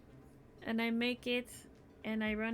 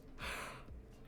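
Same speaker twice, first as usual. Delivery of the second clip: yawn; 11 dB quieter than usual. Real, not acted. Yawns; next says yawn a lot.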